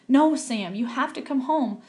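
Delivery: pleading. Acted. Mother pleads with soldier son.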